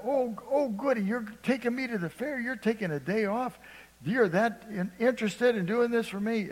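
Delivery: put-on high, childlike squeal of delight; excited little boy. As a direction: squeal like a kid told he's going to the fair. Preacher voices delighted little boy.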